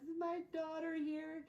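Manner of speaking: high, slow, worried mother calling out; a mother searching for her child, pitched high; anxious mom. Retold or imitated. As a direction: mom bursting in, asking anxiously for her daughter. Mom voiced rushing into station.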